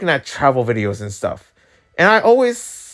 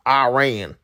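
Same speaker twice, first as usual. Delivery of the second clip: American "Iran", eye-RAIN. Shown as a demonstration. American saying it his country's way, American accent. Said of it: "say it in the American way".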